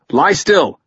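high, loud urgent shout. A narrator's reading. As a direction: shout the warning across the ice. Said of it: "Tucker called".

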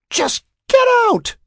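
very high, loud furious shriek; demanding. A narrator's reading. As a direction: shriek the order from another room. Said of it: "Sarah demanded".

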